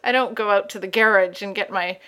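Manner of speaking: ordinary talk, nothing marked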